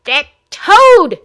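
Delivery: high, loud, raging scream; furious shout. Acted. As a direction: scream it in towering rage. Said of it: "Thessalina shouted".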